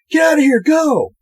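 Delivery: high-pitched shouted order; hammed-up frantic command; acted urgent shout. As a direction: play someone yelling at girls to flee. Acts Kevin ordering the girls out.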